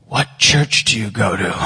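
low, loud put-on voice; suspicious boy. James questioning the stranger about church, low and wary. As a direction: imitate a kid's wary question in low tones.

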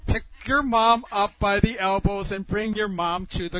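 insistent fatherly instruction; urgent order. Retold or imitated. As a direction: order them firmly and loudly. Father orders sons, quarrel scene.

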